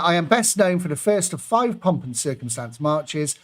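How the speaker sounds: ordinary talk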